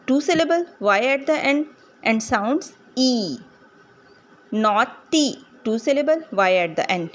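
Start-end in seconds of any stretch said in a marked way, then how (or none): 4.5-5.5 नॉटी broken into नॉ, टी; split syllables. Shown as a demonstration. Teacher reading naughty as an example, split syllables. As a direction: say नॉ, pause, टी. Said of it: "syllables को अलग-अलग करने के लिए".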